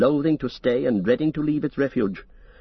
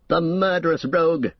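higher angry outcry; crying out; 5 semitones higher than usual. Acted. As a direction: cry it out in outrage. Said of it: "cried Éomer".

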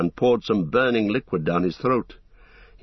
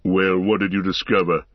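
growling. Acted. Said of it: "growled the voice of Ugluk".

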